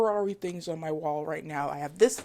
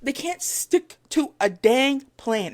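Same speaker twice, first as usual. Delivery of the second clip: exasperated; 7 semitones higher, 7 dB louder, slower than usual. Real, not acted. Venting about team's failed strategy.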